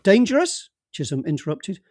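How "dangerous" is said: a hearty call, clearly higher and louder; a breezy senior detective waving him over; jovial boss calling out. Acted. Chisholm cuts in.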